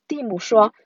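ordinary delivery, nothing marked

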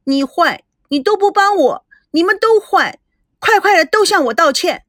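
high, whiny wailing demand; crying out. A narrator's reading. Clinging sister wails at everyone, high whiny crying. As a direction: wail like a spoiled child. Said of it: "哭喊道".

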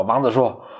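normal talking voice

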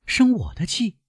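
put-on high, astonished girl's question; taken aback, voice lifted high; surprised question. A narrator's reading. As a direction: pitch it right up in disbelief. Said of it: "惊讶地问".

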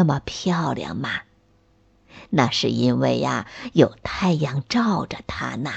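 put-on low, hushed old man; old tree grandpa voice. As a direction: whisper a secret like a grandfather.

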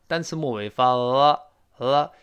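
ordinary delivery

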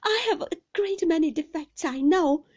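high, loud, distressed confession; pleading apology. A narrator's reading. Mother apologising to her husband, high and pleading.

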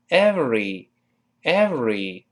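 slow every with a clear v; every drawn out, teeth on lip. Shown as a demonstration. Teacher slowly models every. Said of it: "上牙轻轻接触下唇".

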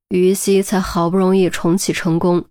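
usual speaking voice

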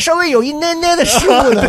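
high mock squeak; squeaky comic voice. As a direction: tease in a squeaky voice. Comic high voice while teasing.

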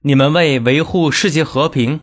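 ordinary talk

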